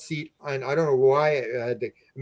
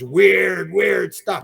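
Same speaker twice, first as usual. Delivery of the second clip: "weird, weird" stretched long and louder; drawn-out WEIRD; 5 semitones higher, 7 dB louder, much slower than usual. Retold or imitated. Man explaining movie theory to friend, drawn-out emphatic "weird". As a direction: lean slowly on each "weird".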